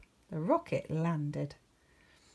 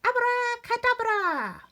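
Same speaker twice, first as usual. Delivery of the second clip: shouted spell; 8 dB louder than usual. Acted. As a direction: cast it with a flourish.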